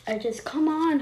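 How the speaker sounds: insistent mother voice. A narrator's reading. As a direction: urge her on like a mother. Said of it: "her mother insisted".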